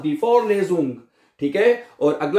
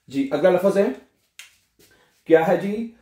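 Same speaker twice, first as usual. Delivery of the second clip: normal talking voice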